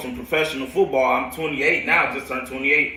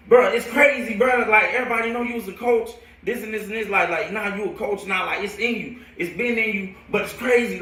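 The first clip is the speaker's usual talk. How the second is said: higher-pitched retold amazement; imitated hype friend; 7 semitones higher than usual. Friend telling him he is a coach now, amazed hype. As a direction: act out a buddy hyping him up.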